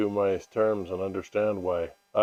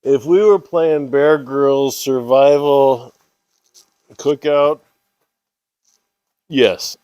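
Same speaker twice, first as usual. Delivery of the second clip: loud, slow, cocky boast; 3 semitones higher, 11 dB louder, slower than usual. Acted. Mock boast about survival show.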